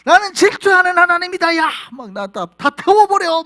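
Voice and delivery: very loud high shout; God furious at idolatry, shouting; jealous God roaring. Acted. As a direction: thunder the threat to burn it all. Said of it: "뭐 이런 얘기는".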